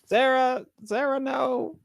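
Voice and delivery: high playful plea; mock pleading. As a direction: plead with her, teasing. Pleading with absent Sarah.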